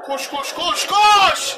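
rising into a yell at the players; frantic shout. Real, not acted. Gamer yelling at his footballers to run, frantic shout. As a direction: scream at your players to run. Urging players in FIFA match.

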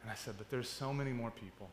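soft, low, tender encouragement; gentle reassurance. Retold or imitated. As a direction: comfort him quietly and kindly. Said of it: "I go".

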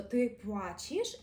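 «ти плачеш» said slowly with a soft ч; teacher shows the soft ч slowly; soft Polish sounds. Shown as a demonstration. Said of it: "і тут же м'якіше звук «ч»".

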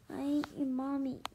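plain reading voice

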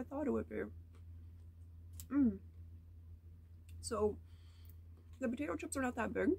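speaking with food in mouth; talking while chewing candy. Real, not acted. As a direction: mouth partly full of chocolate. Seen 0: holds bitten candy cup throughout; chewing faces while she talks.

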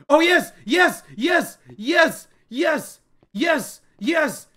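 high, loud repeated cries of victory; triumphant cheering. Real, not acted. Player cheering to viewers after finally escaping. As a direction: celebrate the escape.

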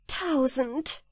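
high cry of amazement; wife exclaiming. A narrator's reading. Wife on hearing of another bottle, exclaiming. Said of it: "rief die Frau".

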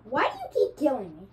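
loud sharp call of outrage; angry shout. Real, not acted. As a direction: yell at the enemy. Repeatedly killed in game.

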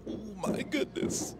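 slow, high breathy oh my goodness; surprised gasp. Real, not acted. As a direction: let it slip out in disbelief. Surprised at a near foul.

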